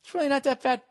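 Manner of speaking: high put-on voice, talking himself into it; mock relieved voice. Homeowner comparing his house to waterboarding, high mock reassurance. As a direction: squeak it out like denial. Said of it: "to be like".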